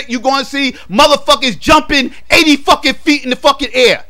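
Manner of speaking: normal talking voice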